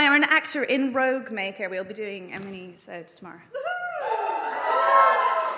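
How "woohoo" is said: high whooping cheer; excited high woohoo. Real, not acted. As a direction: whoop for the guest. Host cheers the mini-sode.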